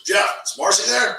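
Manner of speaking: poking fun at a serious friend, mildly high and loud; serious Bob. Retold or imitated. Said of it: "And Bob goes".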